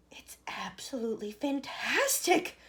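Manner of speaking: slow, breathless awed gasp. A narrator's reading. Grandpa Joe gasping at the miracle chocolate, breathless. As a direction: breathe it out in wonder. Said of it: "gasped Grandpa Joe".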